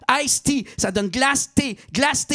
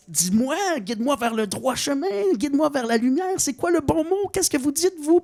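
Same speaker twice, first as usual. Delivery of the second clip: mock pleading; 8 semitones higher than usual. Begging the Frenchman for help.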